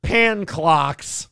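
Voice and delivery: halting learner sounding out words; hesitant beginning reader, lightly imitated; Adam Sandler's character. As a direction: break it up like someone learning to read. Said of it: "trying to learn to read".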